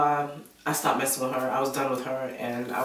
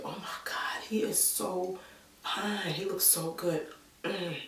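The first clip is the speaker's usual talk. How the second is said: imitated breathy, whispery gushing; hushed swooning; 4 semitones higher, 7 dB quieter, slower than usual. Best friend confiding about the fine boyfriend, hushed swooning. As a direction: gush under your breath, soft and higher. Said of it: "Like that".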